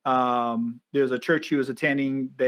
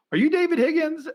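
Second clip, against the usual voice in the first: much higher imitated woman's question; her voice; 13 semitones higher than usual. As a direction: raise the pitch to play the woman asking. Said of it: "She goes".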